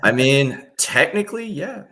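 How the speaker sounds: high-pitched, squeaky "technically, yeah"; hedging admission. Real, not acted. Guest conceding to a teasing host that he technically counts, high hedging voice. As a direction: admit it in a high, rising voice. Sheepish admission to being called contractor.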